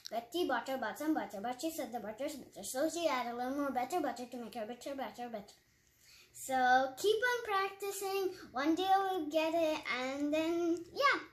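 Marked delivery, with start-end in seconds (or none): 0.1-5.5 smooth and quick after practice; fast confident tongue twister; practiced fast version. Shown as a demonstration. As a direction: rattle it off fast. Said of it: "since I practice, this is how I say".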